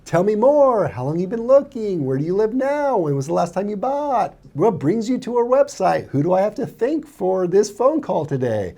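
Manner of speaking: inviting questions with high rising pitch; light, rising, curious questioning; upswing questions. Shown as a demonstration. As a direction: ask warmly, with the pitch lifting up. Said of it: "Upswings are like".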